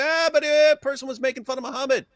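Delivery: high, fast sly excuse; mock excuse. Mocking a killer's excuse to police, high fast mock voice. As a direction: toss off the excuse slyly. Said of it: "I say".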